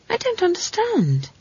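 put-on high, small girl's voice; plaintive confusion, pitched right up; puzzled child. Girl admits confusion to Granny, high and puzzled.

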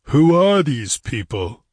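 slow, weighty question; ponderous ruler's voice, drawn out; deep rumbling chieftain. Acted. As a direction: rumble it out like a distant bull. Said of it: "like the low, deep rumble of a distant bull".

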